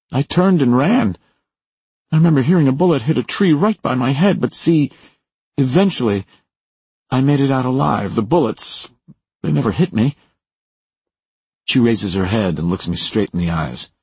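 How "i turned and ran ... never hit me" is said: high, urgent retelling of a narrow escape; frightened Maura. Acted. Maura recalling bullets missing her, high and fearful. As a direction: tell it keyed-up and high-pitched.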